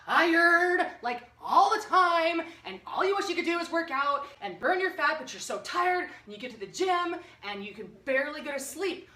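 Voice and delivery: loud, high, hammed-up lament; exasperated complaint. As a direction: exaggerate the misery of being tired. Voices tired viewers' exasperated complaint.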